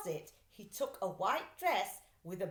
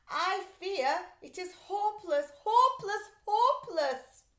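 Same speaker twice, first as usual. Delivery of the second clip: rising louder and higher in despair, hopeless, hopeless; a hammed-up cry of despair, louder with each hopeless; despairing wail; 10 semitones higher, 8 dB louder than usual. A narrator's reading. Despairing museum director.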